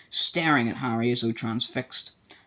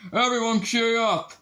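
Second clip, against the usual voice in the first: roared; 11 semitones higher, 4 dB louder, slower than usual. A narrator's reading. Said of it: "Malfoy roared".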